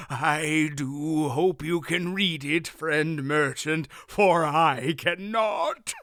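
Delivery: put-on slow, high doddering old man. A narrator's reading. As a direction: play the harmless fool. Wolf plays a foolish old man.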